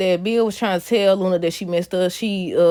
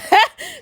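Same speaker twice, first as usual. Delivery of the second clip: laugh; 4 dB louder than usual. Real, not acted. Cracking up at the choking scene.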